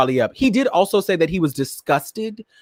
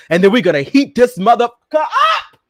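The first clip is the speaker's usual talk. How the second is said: hyped shout; 8 semitones higher, 6 dB louder than usual. Real, not acted. Hyping up the hot topics.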